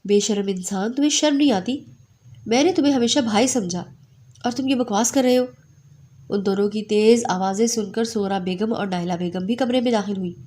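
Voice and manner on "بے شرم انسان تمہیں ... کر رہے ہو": usual speaking voice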